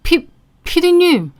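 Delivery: high, slow stammering gasp; shocked stammer. Acted. Boss recognizing the producer, stunned. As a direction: stumble over the word in shock. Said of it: "사장 눈이 갑자기 있는 대로 커지는".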